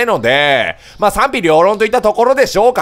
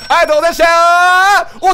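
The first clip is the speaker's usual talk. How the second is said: bright loud shop farewell; 9 semitones higher, 4 dB louder than usual. Pretend. Shopkeeper farewell shout.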